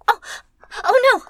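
high, loud alarmed gasp; startled panic. Acted. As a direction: cry out as if about to be caught. Hears footsteps approaching.

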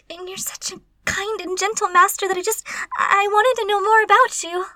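high, loud, flustered pleading; panicked apology. Acted. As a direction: plead for forgiveness after being caught. Caught, pleading with master.

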